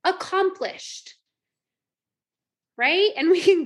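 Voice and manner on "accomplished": much higher, bright boastful cheer; proud cheer. Retold or imitated. Coach voicing a proud inner thought, high and cheerful. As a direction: chirp it like you nailed the day. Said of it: "but in, like".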